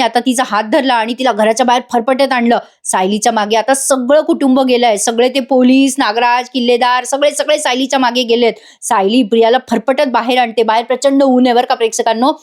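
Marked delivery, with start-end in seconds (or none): none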